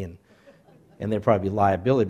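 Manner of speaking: normal talking voice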